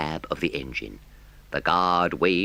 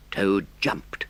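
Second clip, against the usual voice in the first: plain reading voice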